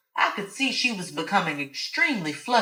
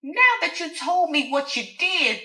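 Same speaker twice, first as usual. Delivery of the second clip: high, spiteful reveal; gloating Alice; 9 semitones higher, 3 dB louder than usual. Acted. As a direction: turn the tables with relish. Alice turns the tables.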